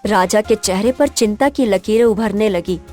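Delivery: ordinary delivery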